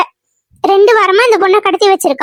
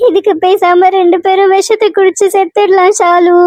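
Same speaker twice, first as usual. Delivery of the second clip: sobbing, despairing cries; wailing lament. Acted. Mother fearing her daughter will never marry, wailing in despair. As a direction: wail in despair, drawing out the cries.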